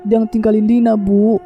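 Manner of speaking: very high, desperate tearful pleading; acted wailing cry. A narrator's reading. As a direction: wail for your parents in a high voice. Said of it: "Lina berteriak sekencang mungkin". (meratap histeris dengan nada tinggi)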